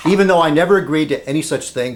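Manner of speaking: normal talking voice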